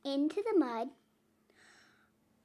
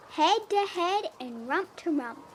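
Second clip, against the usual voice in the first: ordinary delivery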